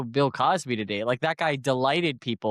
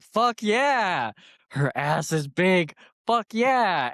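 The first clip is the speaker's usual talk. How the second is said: mock cheering; 5 semitones higher, much slower than usual. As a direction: cheer it like a leering fan. Imagined crowd cheering.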